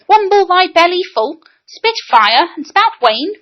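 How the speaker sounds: high, mocking "Wumble" and "wane"; quoting the boy's "wumble" in shock; a touch of scorn on "spout wane". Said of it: "Who ever heard of such things".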